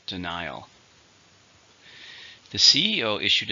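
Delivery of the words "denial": "Denial" with the stress on its second syllable. Shown as a demonstration. Teacher showing learners the stress, "Denial" stressed on the second syllable. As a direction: say "Denial" stressing "ni", softly modelled. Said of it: "stresses on the second".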